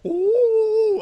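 high, loud thrilled ooh; excited squeal. Retold or imitated. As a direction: squeal with delight at the parcel. Excited about the package arriving.

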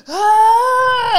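sharp gasp into a loud, high yell; gasp, then burst out "Ah!" very high; mock snorting gasp. Diner acting out snorting a rail, gasp and loud yell. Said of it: "like I snorted a rail".

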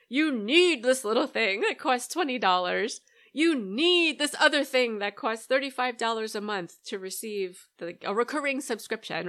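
hammed-up salesman pushing a subscription; ad pitch voice. Mocking online ads, in a loud, pushy advert voice. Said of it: "Most of them say something like".

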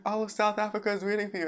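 high, quick imitation of an adoring lady; gushing fan. Lady at Sundance cheering on actor, high gushing fan.

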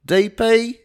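high, loud, slow, stretched-out bad country accent. Host welcoming listeners back in a badly faked country accent. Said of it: "that was a really bad country accent".

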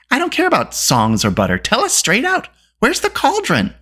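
exasperation climbing to a high demand; bursting out. A narrator's reading. Eilonwy demanding a straight answer about the cauldron, exasperated. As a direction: start impatient, end high and insistent. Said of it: "Eilonwy burst out".

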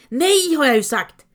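cranky sidekick losing patience; exasperated refusal. Acted. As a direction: snap back in annoyance. Sancho refuses again.

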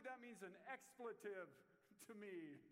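slow, loud and high, with a laugh; an unfiltered, loud outburst; shouting it loud. Retold or imitated. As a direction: blurt the swear out at full volume. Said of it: "Just shouts it loud".

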